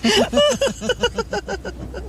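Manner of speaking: laughing. Acted. Still laughing after hair joke.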